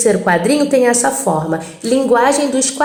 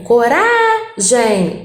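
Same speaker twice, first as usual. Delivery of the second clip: "Coragem" very slow, "ra" stretched, "gem" set apart; stress on middle syllable; much slower than usual. Shown as a demonstration. Teacher showing the tonic syllable, "Coragem" drawn out on "ra". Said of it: "Rá é a sílaba tônica".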